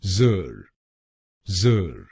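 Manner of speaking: "जर" as a voiced "zer"; syllable demonstration. Shown as a demonstration. Teacher models the second syllable SER, carefully pronounced. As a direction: say "जर" alone as "zer", falling. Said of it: "दूसरे शब्दांश को यानी एसईआर हम बोलेंगे".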